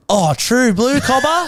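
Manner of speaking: high-pitched, exaggerated Aussie accent; hammed-up Australian. Playing up the accent for an admirer, hammed-up Aussie. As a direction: ham up the Australian twang. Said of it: "hamming it up".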